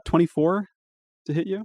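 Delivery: "twenty-four" lifted into a question, "hit you" left hanging high; questioning rise. Real, not acted. Game master unsure the attack roll lands, questioning rise. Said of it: "you say that with a question".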